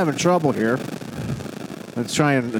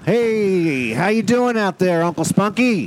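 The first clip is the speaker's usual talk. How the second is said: higher, drawn-out showman's greeting; hearty call-out; 6 semitones higher than usual. Real, not acted. Host greeting Uncle Spunky on the phone, calling out. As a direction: hail a guest down the line. Host hailing a caller on air.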